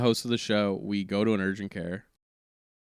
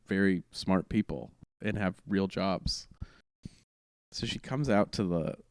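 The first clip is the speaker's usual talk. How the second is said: ordinary talk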